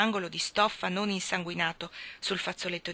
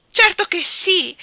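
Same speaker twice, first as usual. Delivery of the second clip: much higher, louder and slow "certo che sì"; unrestrained joy; 12 semitones higher, 11 dB louder, slower than usual. Acted. Nora accepting Jim's proposal, joyful and unrestrained. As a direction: savour the yes, beside herself. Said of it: "esclamò Nora senza il minimo ritegno".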